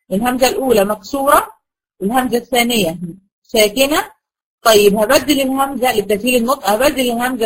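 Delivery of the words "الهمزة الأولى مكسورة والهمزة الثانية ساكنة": usual speaking voice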